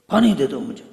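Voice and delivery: put-on loud demanding shout, child asking for water; child's yell. Preacher mimics a child yelling for water, loud demanding shout. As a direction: bark it out like a spoiled child. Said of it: "تو چیخ کر مانگتا ہے".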